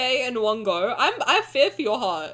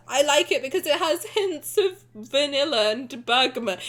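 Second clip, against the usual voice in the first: ordinary talk, nothing marked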